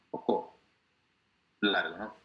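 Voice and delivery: ordinary delivery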